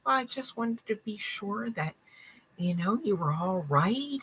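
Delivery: a spirit faking polite concern; sweet-talking spirit. Retold or imitated. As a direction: coax them gently, all false sweetness. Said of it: "speaks to you like this".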